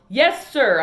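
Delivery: loud yelled answer; yelled back. Acted. Opal yelling back to the preacher across the church. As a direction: shout it back obediently. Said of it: "I yelled back".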